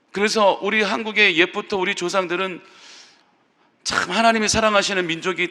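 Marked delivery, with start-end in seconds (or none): none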